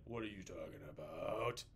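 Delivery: low, fanatical witch-hunter voice; zealous Puritan. Retold or imitated. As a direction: growl like a zealot at a trial. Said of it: "the Puritans are like".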